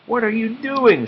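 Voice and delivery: put-on high, bright girl's question. A narrator's reading. Esmeralda asking Nate in the water, high girl's voice.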